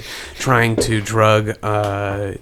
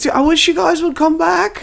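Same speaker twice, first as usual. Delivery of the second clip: high pleading whine; high anxious plea; whiny brother; 4 dB louder than usual. Acted. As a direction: beg them to come back, pitched up.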